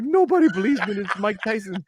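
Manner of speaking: imitating an aggrieved friend; mad. Friend upset over the blurry picture, mad. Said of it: "was so mad at me".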